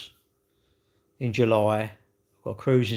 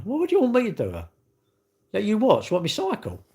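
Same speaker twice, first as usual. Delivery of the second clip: high incredulous; 9 semitones higher than usual. Real, not acted. Mock-outraged reply to chat.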